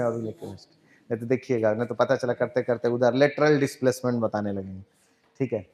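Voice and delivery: low, hushed self-deprecating aside; muttered aside. Real, not acted. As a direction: drop right down and mumble it to yourself. Mock-doubting himself in a muttered aside.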